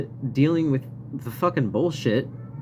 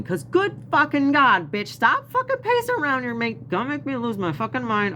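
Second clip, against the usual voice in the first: exasperated outburst; 10 semitones higher than usual. Retold or imitated. Venting at the pacing patients.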